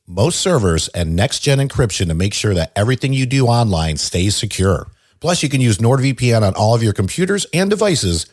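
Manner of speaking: loud, punchy sponsor read. Acted. Host reading a sponsor spot to listeners, upbeat ad voice. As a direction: sell the VPN deal with energy. Reads NordVPN ad.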